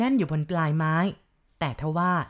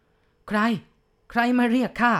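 loud, higher shout into the dark; shouted question; 6 semitones higher, 5 dB louder, slower than usual. Acted. Village chief shouting at an unseen caller, loud startled shout. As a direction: yell it out, gun in hand. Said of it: "กำนันร้องตะโกนถาม".

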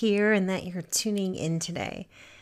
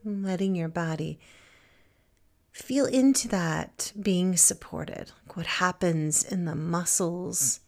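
guided meditation. Acted. Teacher guiding listeners to feel supported and present, calm meditation voice. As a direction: settle them gently into stillness, phrase by phrase.

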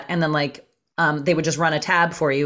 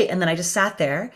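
usual speaking voice

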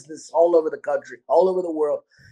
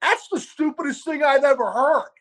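higher, mocking customer voice; imitating an irate customer, higher; rude customer; 8 semitones higher than usual. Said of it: "the guy proceeds to go".

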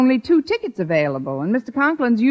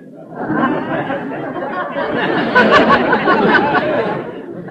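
nervous laugh; 8 semitones higher, 3 dB louder than usual. Acted. Nervous laugh after lunch offer.

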